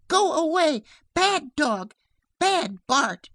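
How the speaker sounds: put-on loud, high little-girl shout; anxious cry. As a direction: scold the dog in a frightened child's cry. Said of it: "she cried".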